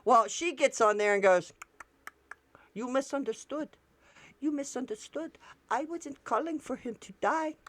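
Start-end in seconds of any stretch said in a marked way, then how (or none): 2.8-7.6 soft, higher, frightened voice; mock scared. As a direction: back down timidly. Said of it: "Yeah, she's scared".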